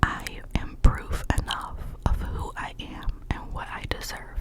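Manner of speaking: usual speaking voice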